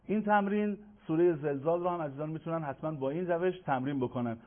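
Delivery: ordinary talk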